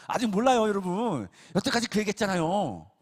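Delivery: preacher insisting loudly to congregation; emphatic. Real, not acted. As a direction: press the point hard on the crowd.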